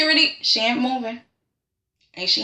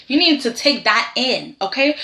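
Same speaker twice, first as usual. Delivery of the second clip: ordinary delivery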